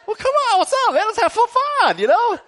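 husband urging his wife to play-wrestle, high and eager; playful coaxing. Retold or imitated. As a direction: egg her on like a big kid.

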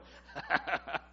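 relaxed, amused chuckle; real run of short laughs. Real, not acted. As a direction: chuckle along after your own joke. Laughs at own age joke.

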